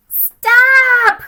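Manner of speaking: high, loud shrieked stop. Acted. Littlest ant shrieking at the marching line, high and loud. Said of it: "Shrieked the littlest ant".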